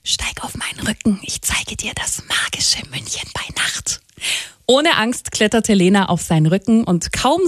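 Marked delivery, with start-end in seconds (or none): 0.0-4.0 soft, voiceless storybook whisper. A narrator's reading. Lion invites Lena onto his back at night, whispered. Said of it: "flüsterte der Löwe".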